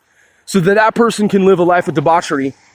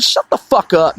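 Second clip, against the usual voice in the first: normal talking voice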